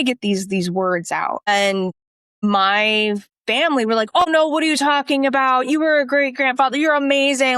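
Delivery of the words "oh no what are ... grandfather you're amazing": higher-pitched imitation of protesting relatives; mock gushing reassurance in a raised pitch; imitated reassuring protest. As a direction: brush it off as the fussing family, pitched up. Voicing family's protests to dying grandfather.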